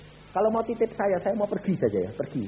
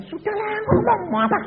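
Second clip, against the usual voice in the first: mock impatient retort; 7 dB louder than usual. Husband snapping at wife.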